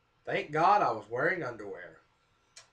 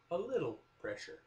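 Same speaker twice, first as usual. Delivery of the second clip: sarcastic echo; 8 dB quieter than usual. Retold or imitated.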